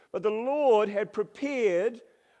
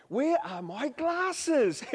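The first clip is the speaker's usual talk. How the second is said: mock lost search; 7 semitones higher, much slower than usual. Searching the house for glasses.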